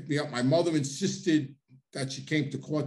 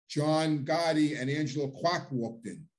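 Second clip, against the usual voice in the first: usual speaking voice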